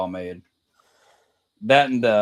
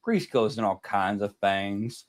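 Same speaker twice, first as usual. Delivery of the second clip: slow, drawn-out Mississippi drawl; a kid from the hills of Mississippi, drawling slowly; slow Southern kid; 2 semitones lower, slower than usual. Retold or imitated. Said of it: "He said the best one".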